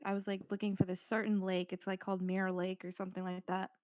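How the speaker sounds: normal talking voice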